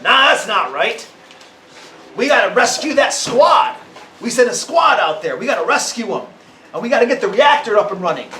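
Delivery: a tough shaved-head soldier refusing the plan; tough soldier woman. A narrator's reading. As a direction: spit it out like a hardened fighter. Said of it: "looking all tough".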